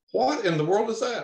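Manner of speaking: high imitated gasp of a question; astonished buddy. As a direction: play the friend seeing something unreal. Buddy sees the cloud.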